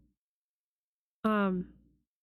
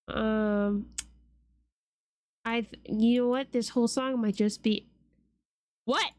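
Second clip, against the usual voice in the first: ordinary delivery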